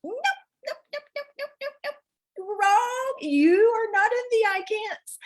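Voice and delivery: Mother Mary. Retold or imitated. Mother Mary correcting the speaker in conversation, high scolding. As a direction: wag a finger with a high sing-song nope. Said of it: "she was like".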